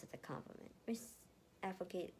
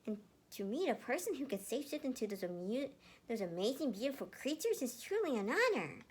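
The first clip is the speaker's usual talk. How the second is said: put-on excited boy; 9 semitones higher than usual. Emil continues gushing.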